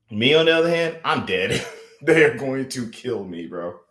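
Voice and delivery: usual speaking voice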